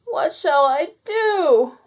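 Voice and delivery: high, slow, loud wail; drawn-out high wail of a little rabbit. Acted. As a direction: wail it like a child in despair. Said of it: "wailed Bertie".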